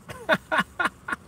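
a shrill, delighted cackle at his own joke; high-pitched cackle. Real, not acted. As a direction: cackle way up high, pleased with himself.

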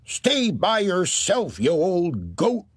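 put-on high, slow mean old woman yelling back; hollering old woman. Wife yelling back at husband's ghost, high mean voice. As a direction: snap at him like a nasty old woman. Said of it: "she hollered back".

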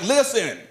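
"Listen" pitched high and emphatic; high, insistent "Listen"; stress on LISTEN. Shown as a demonstration. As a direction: hit "Listen" as a command, falling at the end. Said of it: "Emphasis on listening".

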